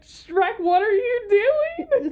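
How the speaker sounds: loud, high, drawn-out mock yell; hammed-up, high-pitched exasperation at a cartoon character; mock exclamation. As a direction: cry out in exaggerated disbelief. Imagined outburst at Shrek while researching.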